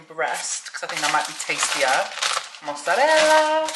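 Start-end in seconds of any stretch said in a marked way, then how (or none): 2.6-3.7 high, drawn-out put-on Italian-ish voice; a playful mock Italian chef; funny voice. Real, not acted. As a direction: sing out the word like a TV chef. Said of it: "Why am I talking like that?".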